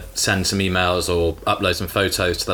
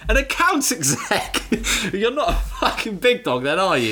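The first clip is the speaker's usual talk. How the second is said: much higher, squealing through laughter; laughing mock taunt; 10 semitones higher than usual. Friend retelling how they mocked Bruno's job title, laughing high. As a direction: tease him while cracking up.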